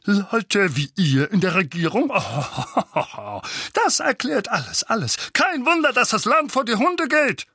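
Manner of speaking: loud, high-pitched ranting with a mocking laugh; scornful indignation, voice climbing high; scornful outburst. A narrator's reading. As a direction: rant in outraged triumph. Outraged uncle rants, laughing scornfully.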